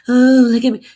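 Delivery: high, loud mocking "look at me"; loud mocking show-off; mock vain voice. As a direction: sneer it like a show-off. Mocking trolls' vain pose.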